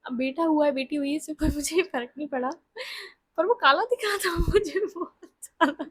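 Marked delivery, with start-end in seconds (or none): 3.4-5.8 giggling through the words; laughing. Real, not acted. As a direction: laughs while saying he looked so dark. Laughing about the dark baby.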